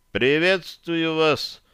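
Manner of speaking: slow, high, exaggerated formal greeting; sarcastic courtesy. A narrator's reading. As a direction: greet him with a mocking verbal bow. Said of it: "вложив в него максимум сарказма". (с предельным сарказмом, церемонно)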